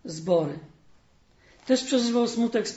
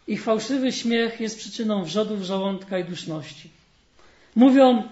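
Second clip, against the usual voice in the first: lively storyteller voice, livelier than the sermon; fairy tale reading; 2 semitones higher, 4 dB louder than usual. Acted. Preacher reading a fairy tale to the congregation, storytelling. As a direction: read the tale warmly to the congregation.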